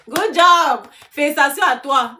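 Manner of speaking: loud, high-pitched cheer; excited congratulations. Real, not acted. Streamer to a loyal viewer, loud excited praise. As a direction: congratulate with a big delighted burst.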